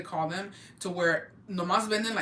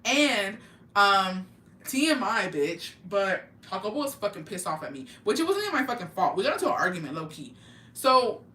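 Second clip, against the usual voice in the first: usual speaking voice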